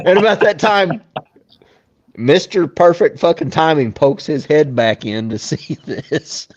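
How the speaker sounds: normal talking voice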